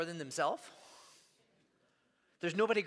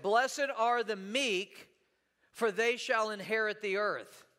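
slow scripture reading; slower than usual. Shown as a demonstration. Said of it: "Can you say that with me?".

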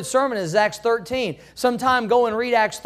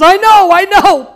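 mock protest; 8 semitones higher, 11 dB louder than usual. Husband at casket, joke.

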